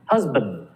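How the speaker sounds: "Husband" with a weak schwa in the second syllable, "huz-bənd". Shown as a demonstration. Teacher models "Husband" for students, schwa on band. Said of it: "Did you hear that?".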